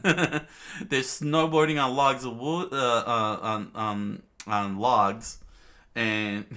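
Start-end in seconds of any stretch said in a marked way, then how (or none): 0.0-2.7 laughing. Real, not acted. Narrator amused by own story.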